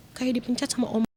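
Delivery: ordinary talk, nothing marked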